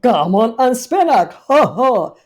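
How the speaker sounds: loud cawing crow of victory; triumphant shout. Acted. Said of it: "shouted Doctor Maggery triumphantly".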